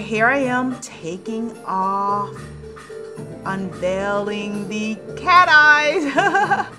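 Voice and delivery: ordinary talk, nothing marked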